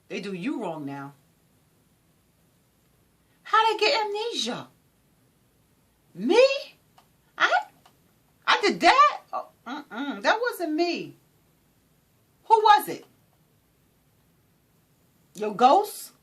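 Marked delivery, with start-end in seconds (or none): 3.4-4.7 high, mocking disbelief; incredulous mock question. Real, not acted. Mocking people who did you wrong, incredulous. 6.1-13.0 high, loud mock-innocent voice; mock denial. Imitating a wrongdoer who denies it, mock-innocent.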